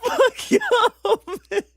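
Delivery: laughing helplessly, loud and high; high laughing curse. Real, not acted. Streamer cursing chat while laughing hard. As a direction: squeal it through laughter.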